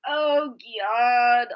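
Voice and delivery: lightly imitating a flustered grandma; grandma's smoker voice. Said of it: "like that".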